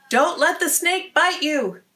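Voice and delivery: a light warning call over the shoulder; calling back. A narrator's reading. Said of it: "he called back to Perpetua".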